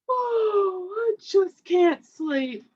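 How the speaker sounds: high, slow moaning complaint; moaning bear. Acted. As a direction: whine it like a sleepless bear. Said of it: "he moans and he sighs".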